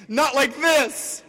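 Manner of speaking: slow anguished high yell; desperate shout. Acted. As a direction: beg at the top of your lungs. Desperate cry to spirit.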